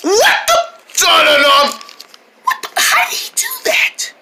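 high, startled squeaks; can't believe it happened; breathless high astonishment; shocked disbelief. Acted. Shield failed, shocked.

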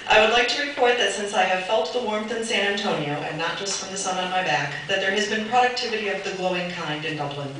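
grandly reads out a flowery letter, half-laughing; formal letter reading. Acted. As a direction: deliver the absent chair's pompous message like a ceremonial speech. Reads absent bid chair's letter.

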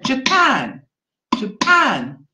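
"Japan" as small beat then big beat on PAN; short JA, weighty falling PAN in "Japan"; stress on second syllable. Shown as a demonstration. Teacher matching taps to the word, "Japan" stressed on PAN. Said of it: "a small tap and a big tap".

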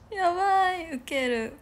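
high, slow laughing cry. Real, not acted. Laughing at her own curtainless room. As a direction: crack up while speaking. Cracking up.

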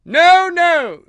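very high, loud queen's objection; shrieked royal refusal; imperious shout. A narrator's reading. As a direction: overrule everyone at full voice. Queen overrules the king.

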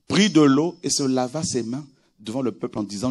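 ordinary talk, nothing marked

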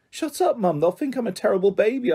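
high, urgent hiss; hissed protest. A narrator's reading. Daughter trying to silence her mum on the phone, hissing. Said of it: "I hissed".

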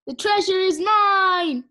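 slow, high, loud victory cry; triumphant gloating. Pretend. Clay claims the queen's treasure, triumphant gloating. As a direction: crow it like a play villain.

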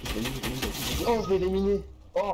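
loud, high triumphant yell; excited shout. Real, not acted. Just eliminated an enemy.

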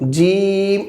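জিম with a long held vowel; drawn-out letter. Shown as a demonstration. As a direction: stretch জিম out evenly. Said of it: "এক আলিফ টেনে বলবো". (জিম এক আলিফ টেনে)

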